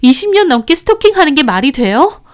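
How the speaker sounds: high, loud, bitter venting through clenched teeth; furious ranting. Retold or imitated. Ex-wife raging to the narrator about her stalker, loud and high. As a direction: spit out the words, outraged and scared. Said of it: "이를 바득바득 가는데".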